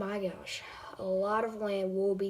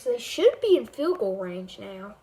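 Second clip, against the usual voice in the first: game commentator calling the drive; amateur play-by-play over a video game; live play-by-play; 2 semitones higher, 4 dB louder than usual. Acted. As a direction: call each snap like a broadcaster.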